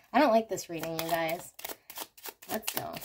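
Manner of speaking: usual speaking voice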